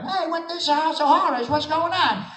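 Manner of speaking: squeaky cartoon robot, wide-eyed and chatty; Wheelie voice. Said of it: "you do your wheelie like".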